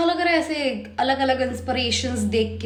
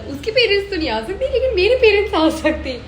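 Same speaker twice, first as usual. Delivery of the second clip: raised, pointed imitation of the line; knowing imitation; 7 semitones higher, 6 dB louder than usual. Replaying the character's hint to her sister, pointed. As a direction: echo it with a knowing push.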